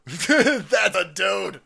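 slow, loud laughing shout. Real, not acted. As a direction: howl it through laughter. Laughing repeat of the joke.

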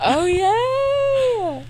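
high, loud delighted cheer; excited squeal. Real, not acted. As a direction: squeal with real joy. Friend's good news.

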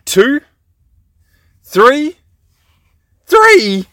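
high coaxing question. Real, not acted. Host coaxing a three-year-old, high playful questions. Host guessing a toddler's age.

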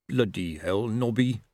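usual speaking voice

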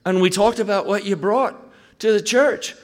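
imitating the Glaswegian pastor's soaring excitement about his church; thrilled Barry. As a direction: climb up into a giddy, breathless report. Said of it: "And he said".

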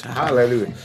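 ordinary delivery

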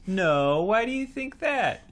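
high, put-on questioning reply; acted coy denial. Acting Jessica Biel's reply to kids.

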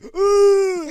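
high, loud drawn-out wail; scream of pain. Retold or imitated. Husband retelling his cramp in bed, screaming. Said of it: "I was screaming too".

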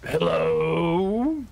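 bears hailing the party; calling out. A narrator's reading. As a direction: stretch it out and lift it like a distant call. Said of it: "Bears call out".

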